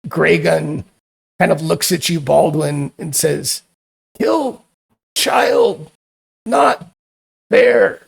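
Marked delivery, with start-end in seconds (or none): 4.2-8.0 very high, halting monster voice; broken creature speech. Acted. Graygun reproaching Baldwin for the killing, in a high halting voice. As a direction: spit out each word as a dying beast.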